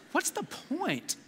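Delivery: ticked-off, high and drawn out; frustrated. Shown as a demonstration. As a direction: say it slowly with passion, like you're fed up. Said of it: "Like you're frustrated. Like you're ticked".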